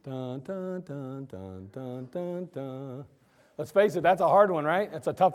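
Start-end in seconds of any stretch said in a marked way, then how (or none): 0.0-3.1 soft, lower timer hum; ticking clock hum. Pretend. Preacher humming a clock while people look up Amos, soft. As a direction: hum out a countdown quietly. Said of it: "The clock is running".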